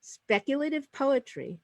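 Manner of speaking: measured, projected announcer delivery; formal host introduction. Real, not acted. Host welcoming the audience to a poetry reading, formal and projected. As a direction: open the event with ceremonial emphasis.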